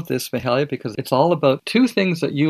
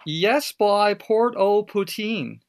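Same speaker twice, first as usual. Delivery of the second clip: put-on high, slow, each word spaced out for "Yes, boy, Port au Poutine"; higher, slower "Yes, boy, Port au Poutine" spoken for a toy to repeat; slow clear phrase for the bird; 6 semitones higher, much slower than usual. Shown as a demonstration. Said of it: "I'm gonna say".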